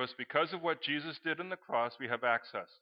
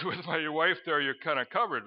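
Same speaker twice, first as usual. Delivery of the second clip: ordinary delivery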